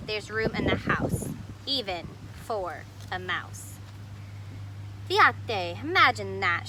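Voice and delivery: put-on lively read-aloud voice for children; storybook reading. A narrator's reading. Teacher reading a picture book to kids, animated. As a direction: read the story to the class with expression.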